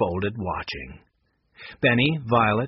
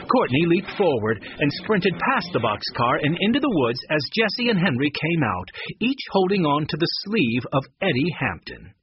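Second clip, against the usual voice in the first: plain reading voice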